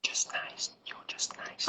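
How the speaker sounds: whispered, very soft aside; modest whisper. Real, not acted. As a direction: wave off the praise under your breath. Brushing off a compliment.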